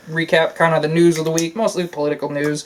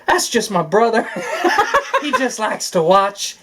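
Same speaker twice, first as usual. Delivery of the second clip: higher, laughing through the punchline; a lightly voiced comedian, laughing; laughing through quote; 5 semitones higher than usual. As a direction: crack up while quoting him. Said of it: "was like".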